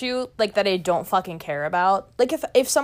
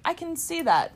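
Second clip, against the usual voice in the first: placating agreement; 7 semitones higher than usual. Acted. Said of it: "I'll just be like".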